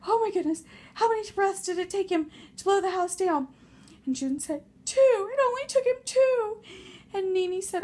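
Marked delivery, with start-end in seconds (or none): 0.1-3.5 higher, fast, breathless fright; breathless panic. Acted. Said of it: "And Nene's like". 4.8-6.6 high, loud scared answer; frightened squeak. Acted. Pig answering in fear.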